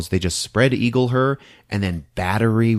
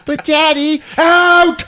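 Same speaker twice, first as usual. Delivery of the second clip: put-on much higher, louder little girl then yell; pleading child and shout; 9 dB louder, slower than usual. Judy pleading with her dad, then shouted out. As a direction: plead then get cut off.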